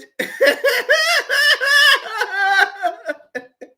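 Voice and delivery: high, loud scornful laughter; loud mocking laugh. Real, not acted. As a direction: laugh them down.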